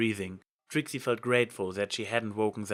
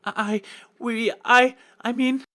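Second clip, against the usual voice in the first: high, broken stammering; panicked stutter; 12 semitones higher, 7 dB louder than usual. A narrator's reading. Trixie stammering before the goddess, panicked stutter. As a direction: trip over the words in fear. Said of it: "Trixie stuttered".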